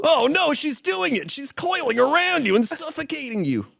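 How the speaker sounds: anime hero. Acted.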